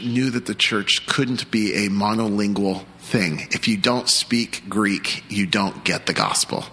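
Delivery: usual speaking voice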